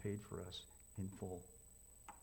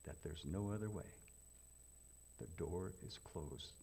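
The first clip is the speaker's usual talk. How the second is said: normal talking voice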